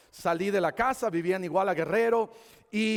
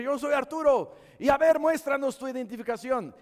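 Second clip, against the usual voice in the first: raised-pitch retold questioning; acted interrogation exchange; 8 semitones higher, 5 dB louder than usual. Supposed police question the boy, mildly heated exchange. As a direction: lightly act out a tense ID check.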